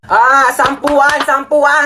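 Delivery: drawn-out slow barker call; hoarse jeepney route shouts. Acted. Jeepney driver calling route.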